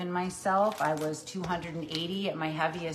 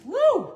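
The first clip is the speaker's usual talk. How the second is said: high, loud "woo"; real gleeful kitchen whoop; excited whoop; 9 dB louder than usual. Real, not acted. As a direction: let out a sudden piercing whoop. Cheers herself on in kitchen.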